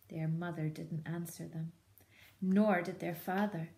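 ordinary delivery

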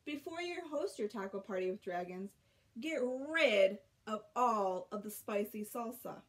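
plain reading voice

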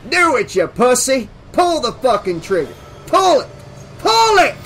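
loud dare building to a high scream; furious goading. Acted.

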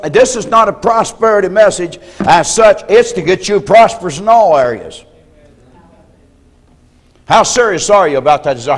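ordinary talk

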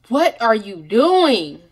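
loud, slow, drawn-out question; emphatic challenge. Real, not acted. Speaker urging people to believe in themselves, emphatic challenge. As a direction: stretch it out and press the point.

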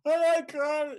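imitating an eerie high wail; mock creature cry. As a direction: squeal it out like the strange animal. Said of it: "the craziest, weirdest sounds".

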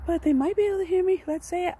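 high, loud sing-out; playful calling out. Pretend. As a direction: project in a high voice for the neighbors. Playing up being heard outside.

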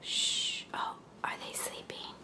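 hushed whisper, no voice. Pretend. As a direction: whisper so they stay asleep. Pretend animals are asleep.

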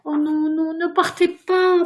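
high, slow, drawn-out pleading; tearful wail. A narrator's reading. Ghost begging Virginia not to leave, tearful wail. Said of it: "pleura le fantôme".

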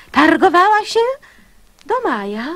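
normal talking voice

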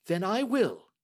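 a brisk, confident Holmes; the detective testing the banker, lightly voiced; Holmes, cool and measured. A narrator's reading. Holmes voiced while reading.

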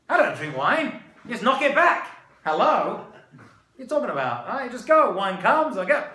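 loud, cocky protest, acted out; mock-indignant bluster; brash incredulous reply. Acting out his naive reply.

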